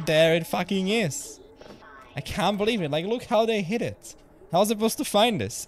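high, loud, laughing disbelief at the find; excited disbelief. Real, not acted. Streamer finds the hidden pizza in the game, excited disbelief. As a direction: shout out the find, amazed and half laughing.

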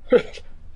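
nervous laugh. Acted. Odd question catches professor off guard.

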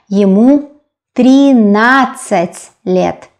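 "тринадцать" drawn out in long syllables; careful, stretched "тринадцать", then a separate "лет"; slow sounding-out. Shown as a demonstration. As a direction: model "тринадцать" slowly for a learner. Teacher sounds out new number.

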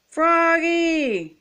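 high, long-drawn calling out; coach's yell. A narrator's reading. Coach summons Froggy, yelling. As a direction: call a player from far away. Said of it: "yelled the coach".

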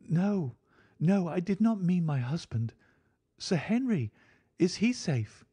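high, insistent, worried woman; anxious woman's voice. A narrator's reading. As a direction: plead for news in a high female voice. Narrator voices Mrs Stapleton.

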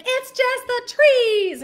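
high, long-drawn holler; loud, stretched-out distant call; yelling from uphill. Retold or imitated. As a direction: imitate mom hollering down a hill. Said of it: "my mom's yelling from up the hill".

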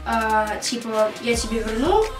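normal talking voice

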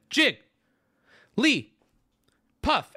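long silence after each of Jig, Lee, Puff; big spaces between syllables. Shown as a demonstration. Streamer drilling chat on syllables, widely spaced. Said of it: "Big spaces in between them".

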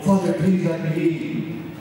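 storyteller's mild imitation of a crying child; crying plea. A narrator's reading. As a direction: beg at the door, with no real sobbing. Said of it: "still crying and banging on the door".

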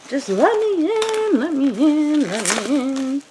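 hammed-up begging at a stubborn box; mock pleading. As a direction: beg the package to open. Struggling to open box.

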